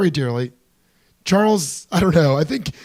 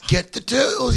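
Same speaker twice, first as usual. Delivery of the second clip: higher villain voice; Niles voice; 7 semitones higher than usual. Retold or imitated. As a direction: bark orders like the villain. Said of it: "he said".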